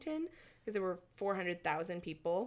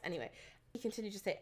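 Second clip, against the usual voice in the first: usual speaking voice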